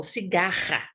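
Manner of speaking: "cigarra" with a rasped throaty RR; strong R. Shown as a demonstration. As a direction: lean on the guttural RR in "cigarra". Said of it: "é igual".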